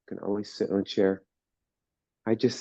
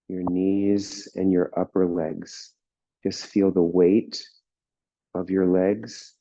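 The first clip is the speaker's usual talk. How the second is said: guided meditation; 3 semitones lower than usual. Real, not acted. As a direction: ease them into stillness, unhurried.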